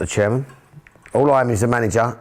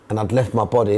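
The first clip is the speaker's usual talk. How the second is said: usual speaking voice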